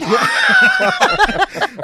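loud high burst of laughter; big shrieking laugh. Real, not acted.

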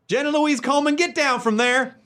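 Southern mom. Retold or imitated. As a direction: call her down like a Southern mother. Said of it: "I can picture a Southern mom".

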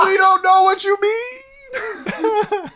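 high, slow, cracking with laughter; crying it out through laughs; laughing shout. Retold or imitated. Mock reply from customers.

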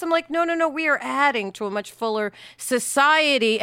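ordinary delivery

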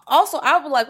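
ordinary delivery

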